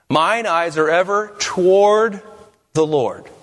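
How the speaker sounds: slow build to a drawn-out "toward"; stress on TOWARD. Shown as a demonstration. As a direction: punch "toward" high, pause before the rest. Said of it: "place the emphasis on different".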